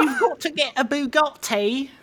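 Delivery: high, put-on Cockney-ish British voice; mock Andrew Tate. Mocking Andrew Tate to podcast hosts, hammed-up British accent. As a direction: ham up a silly British tough-guy accent. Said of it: "If Andrew Tate was like".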